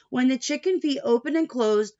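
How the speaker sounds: plain reading voice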